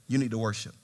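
quiet inner voice. Retold or imitated. Holy Spirit speaking inside him, hushed and low. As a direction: say it like a voice inside your head.

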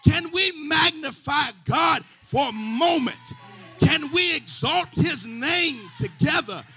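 loud, shouted sermon build with the organ; preaching at full force. Acted. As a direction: whip the congregation up, full voice.

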